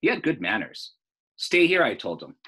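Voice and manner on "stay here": high, loud order to a dog; firm command. Acted. Girl ordering her dog to stay, firm. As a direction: tell the dog to stay, firmly.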